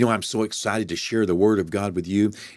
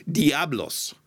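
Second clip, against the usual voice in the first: Diablos with a rolled Spanish flair, pitched up; Spanish accent; 5 semitones higher than usual. Shown as a demonstration. Pastor playfully saying the word in Spanish accent. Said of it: "I'm saying it with a Spanish accent".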